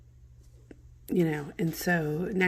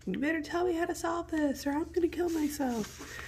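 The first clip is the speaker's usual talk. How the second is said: hammed-up high-pitched ultimatum; Juliet; 12 semitones higher than usual. Juliet demanding the friar solve this, in a high mock-desperate voice. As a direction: mock-desperate girl, much higher pitch. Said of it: "Juliet's now like".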